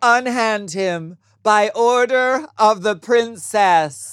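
loud, slow, high theatrical command; hammy stage actor. Pretend. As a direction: declaim like a stage knight.

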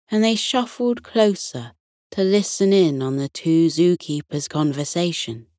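ordinary delivery

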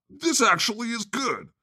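high put-on voice for the listener's thought; a lightly voiced fan; mock inner voice. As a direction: chirp it like a pleased fan.

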